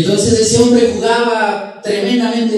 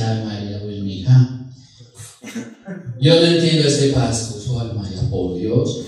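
low, soft plaintive complaint; dejected husband; 8 semitones lower, 3 dB quieter than usual. Acted. Paisa confiding in his wife at night, plaintive. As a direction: moan it wearily to your partner.

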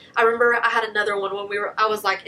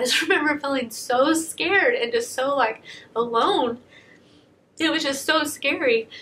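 close to tears; 4 semitones higher than usual. Real, not acted. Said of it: "YouTuber that's crying on camera".

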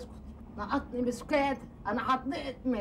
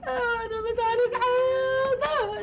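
much higher, louder, slow, crying; sobbing wail; 12 semitones higher, 5 dB louder than usual. Acted. Woman wailing in tears over her disgrace. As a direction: sob it out in a drawn-out howl.

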